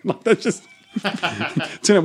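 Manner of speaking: laughing through the words; chuckling at his own stupid chromosome joke. Real, not acted. Laughing at his own joke about boys.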